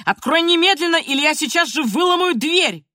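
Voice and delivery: loud, high furious threat; grandfather's mounting shout. A narrator's reading. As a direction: threaten through the door at full voice. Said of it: "расходился всё громче и громче".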